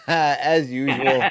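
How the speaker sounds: slow words through a real laugh; laughing as you say it. Real, not acted. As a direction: chuckle it out, amused.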